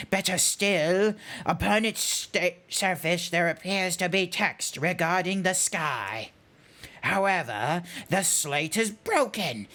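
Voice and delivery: put-on weary old scholar, teeth stuck out; weary character voice. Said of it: "try and do a weary voice".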